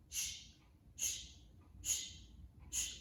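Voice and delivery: long whispered shh; shushing. Acted. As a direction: hush the noisy walks. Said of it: "he says".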